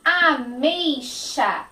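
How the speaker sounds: Ameixa drawn out, xa as sha; slow Ameixa. Shown as a demonstration. Teacher spelling out Ameixa slowly for kids. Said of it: "Esse sonzinho é o sonzinho do C H".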